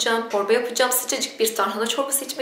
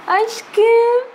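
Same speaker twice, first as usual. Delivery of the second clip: very high cooing pet voice; 13 semitones higher, 9 dB louder than usual. Real, not acted. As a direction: squeal it to a beloved pet.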